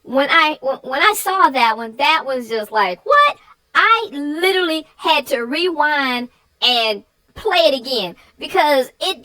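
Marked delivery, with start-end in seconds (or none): 3.1-3.4 high, loud startled what; shocked what. Retold or imitated. Viewer shocked by the dog's singing, loud surprised what. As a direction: blurt it out in disbelief. Shocked reaction while watching video.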